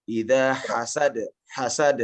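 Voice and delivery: slow "Idza hasad" with the final d bounced at once; qalqalah bounce. Shown as a demonstration. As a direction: let the d of "hasad" rebound without delay. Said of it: "Terakhir juga seperti itu".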